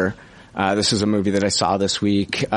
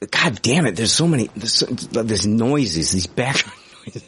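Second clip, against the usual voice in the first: irritated grumble that breaks into a laugh; exasperated griping. Real, not acted. Podcast host griping about background noises mid-review, exasperated. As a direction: moan about it, half laughing. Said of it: "I'm just pissing and moaning".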